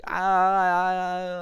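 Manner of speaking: drawn-out mock panicked cry; mock freak-out wail. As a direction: imitate a child freaking out. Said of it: "like, freaking out".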